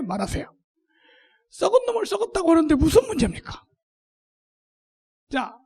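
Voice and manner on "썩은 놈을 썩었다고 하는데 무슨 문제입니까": loud, high defiant cry; preaching shout. Real, not acted. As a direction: rouse the crowd to boldness. Preacher rallies congregation. (힘차게 외치는 설교)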